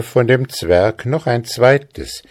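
ordinary delivery

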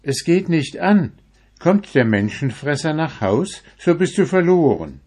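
put-on high, loud old woman voice; old woman warning. A narrator's reading. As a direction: warn him in a high old voice. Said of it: "entgegnete jene".

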